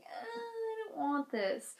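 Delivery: soft, high, slow little whimper; meek. Retold or imitated. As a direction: say it small and timid, holding back panic. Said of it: "on the outside I was like".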